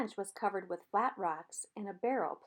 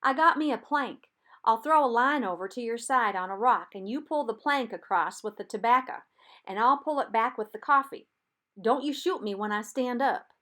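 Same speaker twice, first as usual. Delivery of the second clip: louder, higher call over the water; calling across; 3 semitones higher, 9 dB louder than usual. A narrator's reading. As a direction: lay out the plan, shouting across. Reb explains plan across river.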